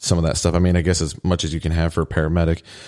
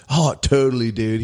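higher-pitched SoCal surfer-dude imitation; Southern California surfer; 4 semitones higher than usual. Patient mimicking his doctor, Southern California surfer talk. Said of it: "He talked like that".

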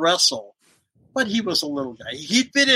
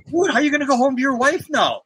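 quick, much higher, teasing fake concern; mock alarm; 8 semitones higher, faster than usual. Wrestlers pranking a drunk buddy about his wife, mock alarmed. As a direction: rush it, high and needling, like you're worried for him.

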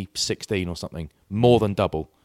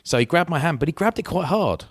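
higher, animated telling; worked-up retelling; 8 semitones higher than usual. Real, not acted. Telling his friend about the stranger grabbing him, worked up. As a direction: relive the grab with rising energy.

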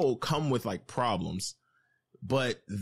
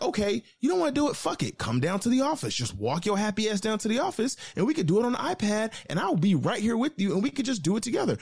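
retold exasperated rant; 8 semitones higher than usual. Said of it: "'cause I was getting frustrated".

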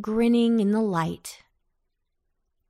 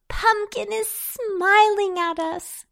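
put-on high, delighted child voice; hammed-up happy little sister; gleeful little girl; 12 semitones higher, 4 dB louder than usual. A narrator's reading. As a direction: pipe it up high with glee. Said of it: "laughed Babette".

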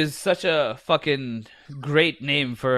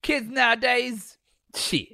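mock Larry; 8 semitones higher than usual. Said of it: "he's like".

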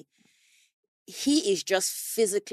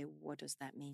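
deadpan husband; 4 semitones lower, 24 dB quieter than usual. Retold or imitated. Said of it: "Like, dead in the eyes".

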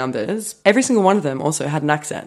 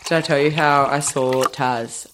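ordinary delivery

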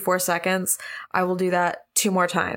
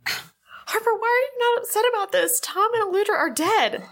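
higher, fast and urgent; frantic; 13 semitones higher than usual. Acted. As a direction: plead with him to understand. Character frantic over dead family.

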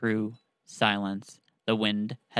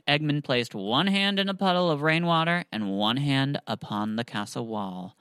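usual speaking voice

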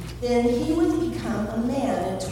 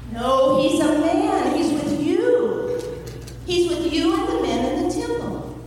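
louder, firm insisting; insisting mother; 6 semitones higher, 4 dB louder than usual. Acted. Mary insisting to Joseph that the boy is with him, louder. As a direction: push back firmly on her husband.

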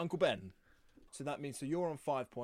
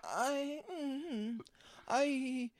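very high, halting, drawn-out oddball voice; awkward, high-pitched sitcom-neighbour impression; [name] doing Thanos; 10 semitones higher, much slower than usual. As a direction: stretch each word high with long gaps. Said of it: "are you [name] doing Thanos".

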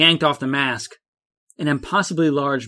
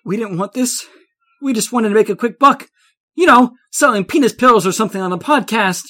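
pleading Aaron; 5 semitones higher, 4 dB louder than usual. A narrator's reading. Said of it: "Aaron said".

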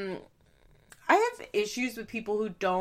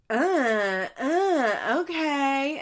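mocking higher-pitched bratty whine; 6 semitones higher, 5 dB louder, much slower than usual. Host imitating a cast member's usual speech, exaggerated whine. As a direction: imitate her whining, drawn out and loud at first. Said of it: "she talks in a whine".

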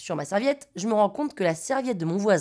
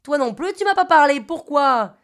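higher, louder Marseille man; pitched-up southern French, Marseille style; imitated Marseille accent; 5 semitones higher, 7 dB louder than usual. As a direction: put on a loud Marseille accent.

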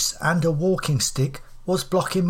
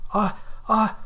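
caught-off-guard stammer, raised pitch; sputtering; 7 semitones higher than usual. A narrator's reading. Narrator caught off guard by the barrage, sputtering. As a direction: start and falter, thrown off. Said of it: "I sputtered, caught off guard".

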